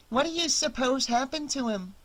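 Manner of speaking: put-on high-pitched small child's question; little girl. A narrator's reading. Little girl asks Annie about the missing dog, child's voice. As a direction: ask it as a curious little kid. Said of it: "the little one asked".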